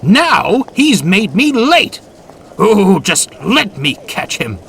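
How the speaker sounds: high, loud furious rant; angry. A narrator's reading. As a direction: rage about the diesel. Gordon fuming about Spam Can.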